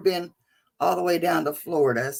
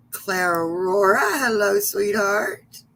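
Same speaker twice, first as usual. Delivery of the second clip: usual speaking voice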